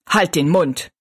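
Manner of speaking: curt, irritated snap; snapping. A narrator's reading. Husband silences his sick wife, snapping at her. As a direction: cut someone off crossly, without shouting. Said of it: "fuhr der Mann sie an".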